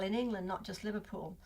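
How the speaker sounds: ordinary delivery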